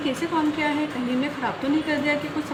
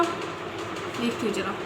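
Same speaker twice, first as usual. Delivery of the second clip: much softer, slow aside to herself; muttered aside; 7 semitones lower, 7 dB quieter, much slower than usual. Acted. Mother muttering to herself while searching, softer and slow. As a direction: mutter it under your breath.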